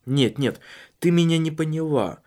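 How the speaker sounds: ordinary talk, nothing marked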